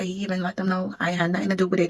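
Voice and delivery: ordinary talk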